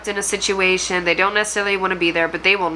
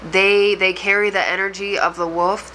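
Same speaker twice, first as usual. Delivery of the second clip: ordinary talk, nothing marked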